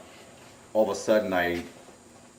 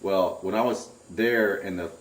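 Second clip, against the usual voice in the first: ordinary delivery, nothing marked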